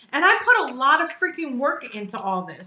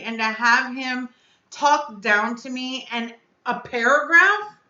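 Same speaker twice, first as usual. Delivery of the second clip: ordinary delivery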